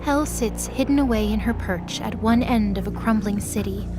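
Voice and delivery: ordinary talk, nothing marked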